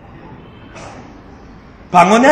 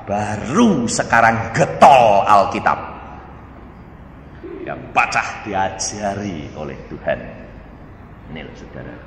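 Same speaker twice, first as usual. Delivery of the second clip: ordinary talk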